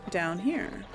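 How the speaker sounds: ordinary talk, nothing marked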